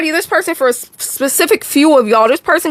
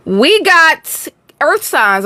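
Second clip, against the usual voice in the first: drawn-out announcement; 4 semitones higher, much slower than usual. Acted.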